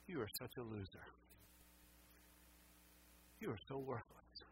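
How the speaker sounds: low, very soft condemnation with long pauses; hushed, deliberate belittling; Satan's accusing voice. Retold or imitated. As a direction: quietly imitate an inner accuser. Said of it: "That's Satan's voice".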